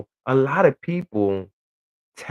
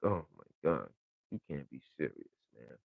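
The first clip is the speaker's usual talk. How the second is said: much lower and softer, under the breath; muttered disbelief; 11 semitones lower, 16 dB quieter than usual. Retold or imitated. Hearing absurd claims, stunned low muttered disbelief. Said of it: "I just be like".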